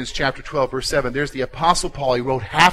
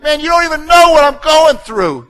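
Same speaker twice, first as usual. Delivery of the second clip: much higher and louder, fed-up cry; exasperated listener; 11 semitones higher, 8 dB louder than usual. Retold or imitated. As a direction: let the frustration burst out at the pastor. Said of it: "and you're like".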